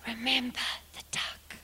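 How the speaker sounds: sly unvoiced whisper. A narrator's reading. Sister threatening brother at the table, whispered. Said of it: "what she whispered under her breath".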